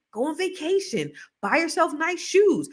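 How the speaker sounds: normal talking voice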